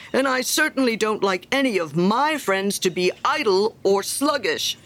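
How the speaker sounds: loud, emphatic lecturing with big pitch swings; self-important miller. Acted. Miller scolding little Hans for laziness, pompous and preachy. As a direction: preach at a friend, drawing out key words.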